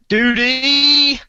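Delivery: cheery sing-song call of a friend's name; a loud, high, teasing shout of the name; playful call. Acted. Playfully calling out co-host's name.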